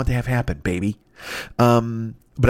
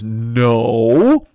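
a blunt, louder "No."; curt refusal; 3 semitones lower, 5 dB louder than usual. Retold or imitated. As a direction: shut him down in one word. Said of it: "Edgar Allan Poe just says".

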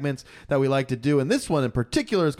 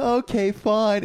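grudging okay; 8 semitones higher, much slower than usual. Pretend.

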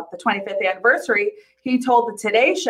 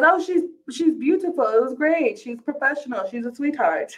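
lightly put-on Italian accent, higher; a mild Italian accent, higher pitch; 5 semitones higher than usual. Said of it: "that's my Italian accent".

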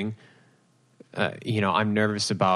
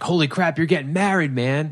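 higher-pitched amazed guest; excited congratulations; 6 semitones higher than usual. Acted. Guest at the rehearsal dinner, excited congratulations. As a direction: gush like an excited buddy.

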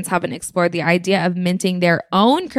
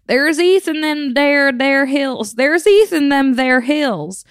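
higher, drawn-out prospector proclamation; old-timey prospector; 6 semitones higher, 3 dB louder, slower than usual. Acted. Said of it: "dressed up as an old-timey prospector to proclaim".

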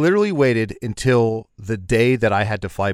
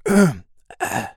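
weak, feeble hello; a sick-voice hello, fading away; fake sick voice; 9 semitones higher, much slower than usual. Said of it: "I'll practice my sick voice".

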